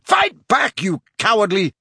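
high, enraged scream; screamed insult, cut off; Harry. A narrator's reading. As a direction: goad the enemy at full pitch. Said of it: "Harry screamed at him".

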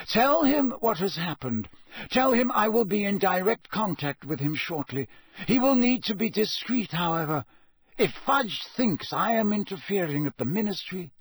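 instructing Bill with firm urgency; Dumbledore urgent. A narrator's reading.

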